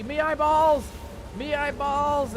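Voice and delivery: loud high pirate cry; pirate yelp. Acted. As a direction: cry out at the blinding light. Bright portal light, pirate cry.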